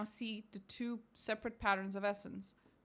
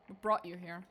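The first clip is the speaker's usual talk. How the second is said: ordinary talk, nothing marked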